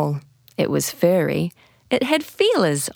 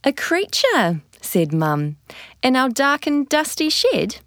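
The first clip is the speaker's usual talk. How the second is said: higher, lightly surprised mum; mild surprise; 7 semitones higher than usual. A narrator's reading. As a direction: echo it back, a touch amazed.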